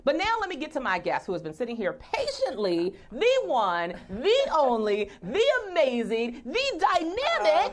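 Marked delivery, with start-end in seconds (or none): 3.1-7.7 slow, rising hype build; announcer hype. Acted. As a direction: build up the guest like an MC. Hyping up her guest.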